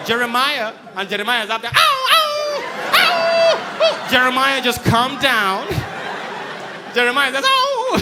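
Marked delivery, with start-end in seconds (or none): 1.7-4.1 high, loud mock dog howl. Dog stuck up on the fourth floor, a high mock howl. 4.1-5.7 calling up to dog. Retold or imitated. 7.5-8.0 high, loud mock dog yelp; dog howl. The dog answering its owner, a high mock howl.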